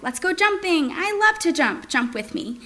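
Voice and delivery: high, bouncy play voice; playful excited. Shown as a demonstration. Parent inviting a child onto the trampoline, high and playful. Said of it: "to make".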